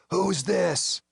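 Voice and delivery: harsh high rasping question; raspy hoarse voice on the phone; rasped. A narrator's reading. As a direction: rasp it out like a ruined throat. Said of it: "it rasped".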